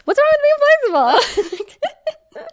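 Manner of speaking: very high, slow, laughing; high mock question. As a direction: squeak it out in disbelief. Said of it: "No extortionists".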